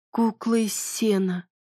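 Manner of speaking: slow, thoughtful echo; echoing repeat. Acted. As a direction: repeat it slowly, lost in thought. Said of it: "эхом повторила Бонни". (медленно, эхом)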